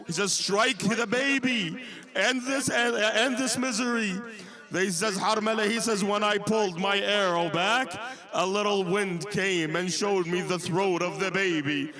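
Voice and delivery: impassioned sermon narration, pitch climbing; impassioned preaching. Acted. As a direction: preach the martyrdom story with mounting intensity.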